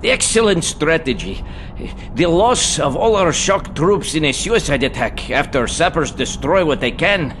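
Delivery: high, hesitant fawning voice; nervous flattery. Acted. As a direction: flatter him while afraid. Officer nervously flatters his boss.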